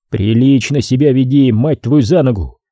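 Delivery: ordinary delivery, nothing marked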